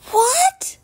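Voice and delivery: high, loud shocked cry; shocked gasp. Acted. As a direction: squeal out the shock. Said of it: "Jameson gasped".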